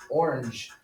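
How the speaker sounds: "orange" said OR-unzh with a reduced second vowel; English pronunciation. Shown as a demonstration. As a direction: stress the first syllable of "orange", English style. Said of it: "we also say in English".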